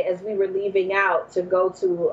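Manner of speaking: ordinary talk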